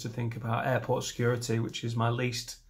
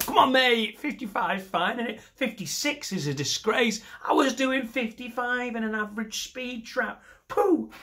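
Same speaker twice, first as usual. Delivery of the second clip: mock indignant rant; 10 semitones higher than usual. Ranting about speeding fine.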